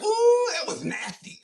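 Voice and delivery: high, slow, laughing mock taunt; imitating Favre's teasing. Quarterback needling the rookie, high laughing tease. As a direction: tease him like a trash-talking quarterback. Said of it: "Brett Favre was like".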